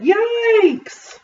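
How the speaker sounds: high, drawn-out yelp; alarmed yelp. A narrator's reading. Father alarmed again at the cart.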